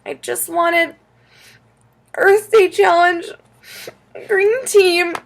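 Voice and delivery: put-on high, halting child in tears; sniffling. A narrator's reading. Upset child explains to mom through tears, sniffling. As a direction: choke it out between sniffles. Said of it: "I sniffled".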